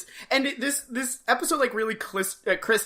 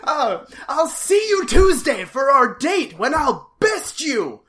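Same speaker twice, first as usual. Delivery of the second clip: hammy villain vow; 6 semitones higher, 9 dB louder than usual. Retold or imitated. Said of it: "shaking his fist at her".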